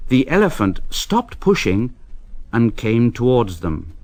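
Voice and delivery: ordinary delivery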